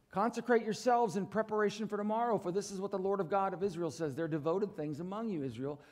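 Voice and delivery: ordinary talk, nothing marked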